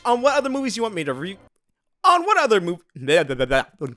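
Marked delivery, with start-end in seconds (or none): none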